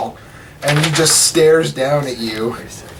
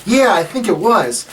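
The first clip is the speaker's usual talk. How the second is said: higher-pitched nephew voice; Rendil; 8 semitones higher, 3 dB louder than usual. Acted. Nephew confirming who captured him, higher voice. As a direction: answer in a lifted character voice. Rendil answers his aunt.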